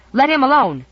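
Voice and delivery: loud angry shout; red with rage, snap it out loud. A narrator's reading. Kurt shouts at Jenny in defense of Tuppy. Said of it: "he shouted".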